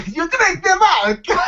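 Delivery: high, slow, hammed-up Russian accent. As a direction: have another go at a comic Russian. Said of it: "I also do a pretty good Russian impersonation".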